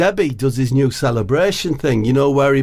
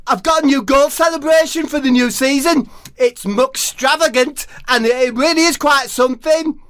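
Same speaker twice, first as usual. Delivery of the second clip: high proud Debbie voice; 12 semitones higher than usual. Acted.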